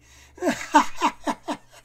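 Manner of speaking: high, loud burst of laughter; roaring children's laugh. Acted. Children laughing at the monster's name, high and loud. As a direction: laugh your head off. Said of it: "se mirent à rire à gorge déployée".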